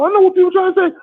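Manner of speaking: high falsetto mimicry of a woman mid-argument; high mock indignation; angry woman neighbour. Voices neighbour woman yelling in argument.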